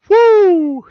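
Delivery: high, loud put-on yelp of disgust; a shrill recoiling whoo. Ex-wife smells him, a high loud disgusted yelp.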